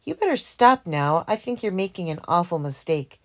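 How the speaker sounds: ordinary delivery, nothing marked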